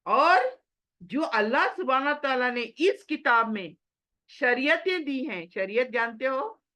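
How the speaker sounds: normal talking voice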